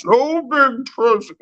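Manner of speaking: very high, slurred mock Spanish accent; drunk Spaniard. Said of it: "like a fucking drunk Spaniard".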